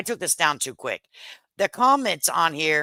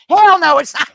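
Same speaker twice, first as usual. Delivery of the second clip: loud high mock refusal; husband's loud no; 11 semitones higher, 10 dB louder than usual. Wife imitating husband shouting no at the question. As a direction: shout it back like an offended husband. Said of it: "He's like".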